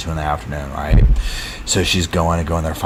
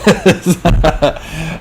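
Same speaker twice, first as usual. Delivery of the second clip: bright laugh; 9 semitones higher than usual. Real, not acted. Laughs at donation.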